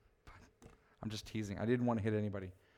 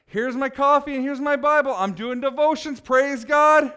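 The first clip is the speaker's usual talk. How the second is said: mock gushing poser; 5 semitones higher, 5 dB louder than usual. Mock poser showing off piety.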